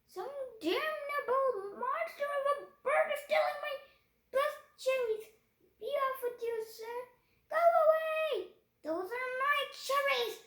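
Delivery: furious shout. A narrator's reading. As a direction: bellow for the gun in outrage. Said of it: "the Duke was shouting".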